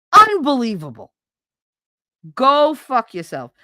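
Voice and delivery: loud angry brush-off. Real, not acted. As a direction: snap it at him in disgust. Ranting at ex-husband.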